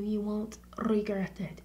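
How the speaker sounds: whispered reassurance; hushed tip to a friend; conspiratorial whisper. Real, not acted. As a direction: lean in and whisper the promise. Whispered sales pitch.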